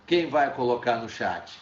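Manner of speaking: normal talking voice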